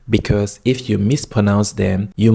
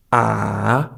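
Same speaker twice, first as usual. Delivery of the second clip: ah drawn out, down then up; dipping third tone. Shown as a demonstration. Teacher recapping the tones, ah falling then rising. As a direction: let a long ah dip, then climb. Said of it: "goes down and then up again".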